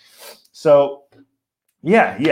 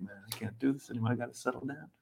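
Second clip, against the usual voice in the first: fast, much softer mock resignation; quick hushed mock sigh of surrender; fed-up mutter; 18 dB quieter, much faster than usual. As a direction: rattle it off under your breath, giving up. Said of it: "if you're in Germany".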